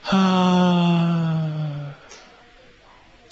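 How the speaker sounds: long, even, loose "haa" on the out-breath; relaxed voiced sigh. Shown as a demonstration. Teacher showing the class a loose breathy hum. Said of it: "吐き出すときに音にしてみましょう".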